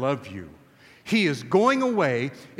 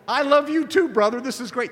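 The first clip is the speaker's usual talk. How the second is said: much higher, louder, beaming with affection; gushing love fest; 7 semitones higher, 5 dB louder than usual. Pretend. Jesus imagined gushing to Peter, excited and loud. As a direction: overflow with brotherly joy. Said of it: "a love fest where Jesus would go".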